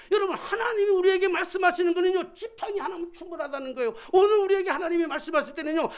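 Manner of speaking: high, loud sermon climax; full-force preaching. Real, not acted. Preacher tells congregation a staff is enough, full-force preaching.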